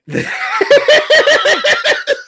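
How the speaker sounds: loud, high pulsing mimicked noise; imitated noise. Comedian showing what happens to the joint, loud mimicked noise. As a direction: exaggerate the noise in rapid high bursts. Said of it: "And your shit's like".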